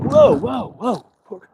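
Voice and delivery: high startled whoa that drops away; hammed-up whoa, whoa, whoa; mock Trump alarm. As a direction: pull up short like stopping a deal. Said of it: "Trump's like".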